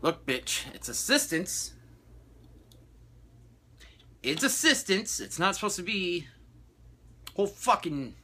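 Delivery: mock fed-up agent. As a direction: tell her off in your head. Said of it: "And I'm like".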